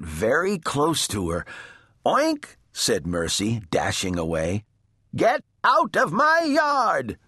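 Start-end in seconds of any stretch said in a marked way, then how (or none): 2.0-2.6 high, perky pig oink. A narrator's reading. Mercy the pig dashes away from Eugenia, high oink. 5.1-7.2 very high, drawn-out shout. A narrator's reading. Eugenia chases the pig from her yard, high indignant shout. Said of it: "shouted Eugenia".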